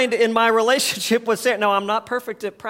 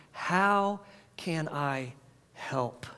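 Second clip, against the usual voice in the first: very slow, much softer and lower; tender offer; 4 semitones lower, 10 dB quieter, much slower than usual. Shown as a demonstration. Pastor showing the true compassionate reply, slow and tender. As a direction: let it fall gently.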